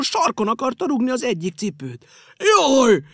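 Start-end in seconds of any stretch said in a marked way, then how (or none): 2.4-3.0 loud, high, slow yelp; his ankle kicked by a shoe; pained cry. Acted. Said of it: "nyögte megint".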